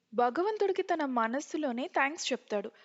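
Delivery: ordinary delivery, nothing marked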